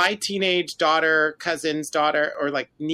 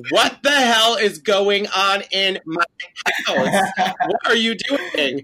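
hammed-up outrage, slow, loud and high; 5 semitones higher, 4 dB louder, slower than usual. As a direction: stretch each word in mock disbelief.

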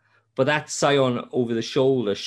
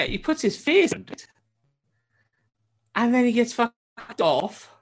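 excited retelling; 11 semitones higher than usual. Real, not acted. Excited retelling of game scene.

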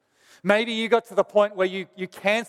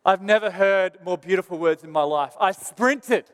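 usual speaking voice

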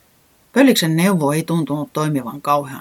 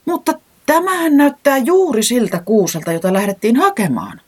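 high, loud astonished cry, settling down; can't believe his eyes; amazed exclamation; 10 semitones higher, 5 dB louder than usual. A narrator's reading. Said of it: "huudahti säämies hämmästyneenä". (korkea ja kova hämmästynyt huudahdus)

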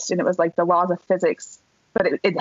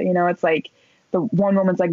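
ordinary talk, nothing marked

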